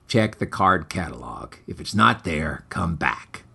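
low, soft, uninterested reply; barely bother to answer, deep and quiet; bored librarian. Acted. Librarian answers the hero without looking up from his paperback, low and quiet.

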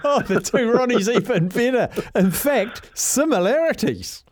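high, laughing through the words. Real, not acted. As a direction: giggle while saying it. Laughing at comparison.

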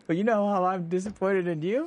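höher, andere Klangfarbe, spöttisch nachgeäfft; mock Marvin retort. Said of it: "Marvin says".

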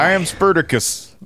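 high-pitched mock Pittsburgh accent. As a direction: declaim the film line in a Pittsburgh accent. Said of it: "in the pit accent".

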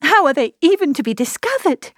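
high, anguished hopelessness; distraught, pitched high and swelling at the end; despairing. A narrator's reading. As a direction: lament that all is lost. Despairing outburst over the elopement.

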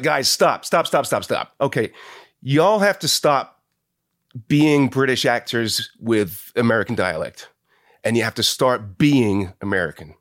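imitated American accent, emphatic; drawn-out, insistent American director imitation. Actor quoting his director in rehearsal, American accent. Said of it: "the American director at one point".

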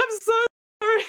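high-pitched mock-teary apology. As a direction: plead for forgiveness, half laughing. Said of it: "I'm just like".

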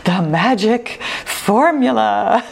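slow, drawn-out dramatic title; super dramatic voice. Shown as a demonstration. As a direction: stretch the title out like an epic trailer. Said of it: "my super dramatic voice during the intro".

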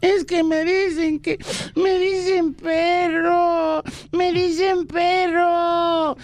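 put-on high, slow, sobbing little boy; whiny child. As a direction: wail it like a crying kid. Sohn klagt weinend.

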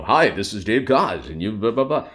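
smooth announcer voice; radio voice. Retold or imitated. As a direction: put on a radio DJ voice. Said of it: "I was putting on the radio voice".

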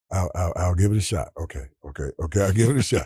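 low, halting repeats of each phrase; little stutter. Retold or imitated. Quarterback agreeing to give it a shot, little stutter. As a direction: imitate a hesitant man talking himself into it. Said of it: "he had a little stutter in his voice".

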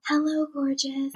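slow, soft, put-on sweet voice; a hint of a timid church mouse greeting the camera; light camera voice. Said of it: "the voice gets so light".

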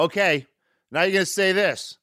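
feed instructions to an actor mid-take; director giving orders. Retold or imitated. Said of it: "he'd go".

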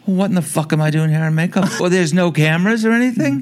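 high-pitched indignant outburst; mock outrage, pitch shooting up; outraged disbelief. Star outraged, crew missing.

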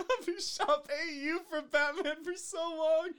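high, loud and laughing. Acted. Laughing mock pronouncement.

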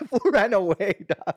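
ordinary talk

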